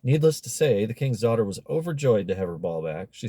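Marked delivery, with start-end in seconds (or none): none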